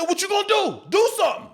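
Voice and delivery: high, insistent dare; goading challenge. Retold or imitated. Older actor daring the younger one to fight, high and goading.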